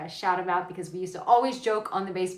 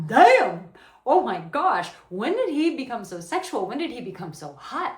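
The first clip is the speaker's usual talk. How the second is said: loud, higher, gushing at first; turned-on amazement; 4 semitones higher, 7 dB louder than usual. Acted. Woman marveling at how sexual he became, turned on. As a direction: burst out impressed, then ease off. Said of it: "really turned on to where she's like".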